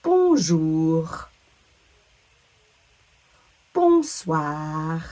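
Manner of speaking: "Bonjour" and "Bonsoir" ending in a back-of-throat R; slow, careful demo of final French R; throaty French R. Shown as a demonstration. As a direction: draw out each word into a French throat R. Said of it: "sound in the back of our throat".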